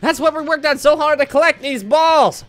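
high, impassioned cartoon speech; rallying game character.

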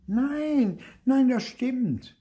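very high, loud protest; high-pitched, drawn-out insistence; insistent denial. A narrator's reading. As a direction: protest like a child accused of lying. Clara denies lying, siblings quarrel.

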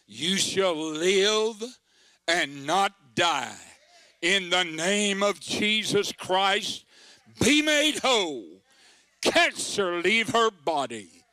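slow, loud healing proclamation; preaching command. Acted. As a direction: declare it with full authority, slowly. Commanding healing over the sick.

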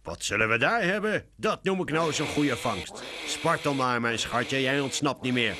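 high-pitched villain relishing his catch; gloating cat catcher. A narrator's reading. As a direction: gloat over trapped prey. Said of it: "lacht de kattenvanger".

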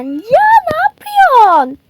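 a trapped little bear shrieking in fear; panicked squeal. Acted. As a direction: shriek in panic as you're shoved. Captive panics.

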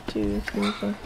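ordinary delivery

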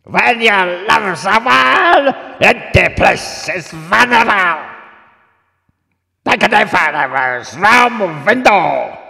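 put-on high, very loud screech with a Russian accent; drawn-out feline shriek, put-on Slavic accent; Russian screaming cat. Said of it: "some sort of Russian screaming cat".

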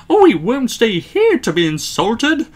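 high, loud indignation; fuming. A narrator's reading. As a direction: protest as offended coaches. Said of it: "they fumed".

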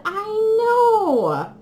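high teary squeal of joy; excited squeal. Real, not acted. Telling her friend about the gift, squealing excitedly. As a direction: squeak it out nearly crying.

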